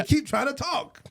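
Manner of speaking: high, hammed-up protest; mock complaint. Jokingly acts out not being heard.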